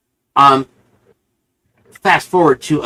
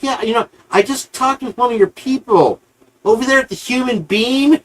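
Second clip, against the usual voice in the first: higher, sweetly innocent teasing; mock-innocent reveal on the phone; sly gotcha; 10 semitones higher than usual. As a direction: let him know he's caught, all innocence. Springing the trap on the phone.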